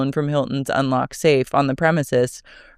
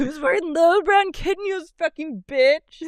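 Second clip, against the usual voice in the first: mocking Alexis; 12 semitones higher than usual. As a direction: ham up her snotty complaint. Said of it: "She said".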